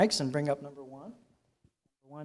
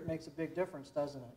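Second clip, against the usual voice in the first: distant and faint, spoken away from the mic; off-axis mic; 2 semitones lower, 19 dB quieter, faster than usual. Shown as a demonstration. Presenter shows the class an off-axis mic, sounding distant and faint. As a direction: turn the mic aside so the voice drops away. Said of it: "point this directional microphone over here".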